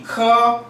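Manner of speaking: 커 held high and level; first tone. Shown as a demonstration. Teacher reading ke in a high level first tone. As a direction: say 커 high and flat like a Mandarin first tone. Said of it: "K도 영어랑 K랑 음가가 똑같습니다".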